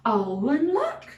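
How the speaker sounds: ordinary delivery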